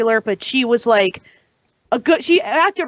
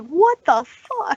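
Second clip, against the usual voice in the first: slow, higher, drawn-out disbelief; retold disbelief; 4 semitones higher, much slower than usual. Podcaster recalling leaving the theater dazed, retold disbelief. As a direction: stretch it out in baffled disbelief. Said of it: "I was just like".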